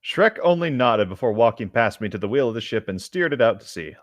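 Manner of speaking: usual speaking voice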